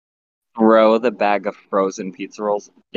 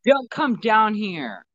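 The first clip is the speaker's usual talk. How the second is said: shouting up to mom; 11 semitones higher than usual. Pretend. Son calling up to his mom from the basement. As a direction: call up to keep her out.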